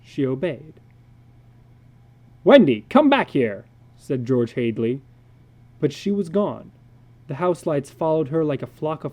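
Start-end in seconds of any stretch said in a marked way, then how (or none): 2.4-3.7 put-on loud call after a child running off; father calling after his daughter. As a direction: call her back down the hall.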